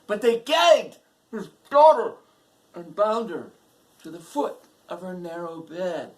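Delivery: very loud, high outburst easing slightly; outraged dramatic cry. A narrator's reading. Narrator telling of the gagged daughter, dramatic cry. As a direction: cry out the horror, then pull back a little. Narration of soldiers' violent act.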